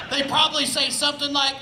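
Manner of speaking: ordinary talk, nothing marked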